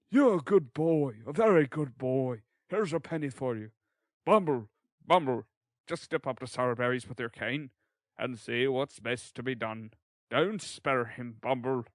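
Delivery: put-on hearty, patronizing old-fashioned gentleman; character voice of an approving gentleman. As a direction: praise the boy, then send the beadle off with relish. Figure of the gentleman in the waistcoat.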